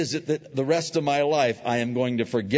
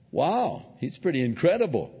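mock impressed; 5 semitones higher than usual. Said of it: "God wasn't going".

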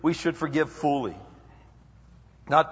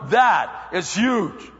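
louder, slow amazed shout; hammed-up impressed disciple; awed Peter; 5 semitones higher, 6 dB louder, much slower than usual. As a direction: marvel at his own generosity. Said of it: "Pete's going".

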